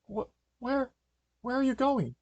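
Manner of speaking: high-pitched, stammering bewilderment; bewildered question. Acted. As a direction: call after them, high and puzzled. Elizabeth questions the departing dog.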